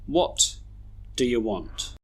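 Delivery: "do you" reduced to a quick duh-yuh; schwa weak forms. Shown as a demonstration. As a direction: pause after "What", then weak "do you". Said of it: "we should read these sentences like this".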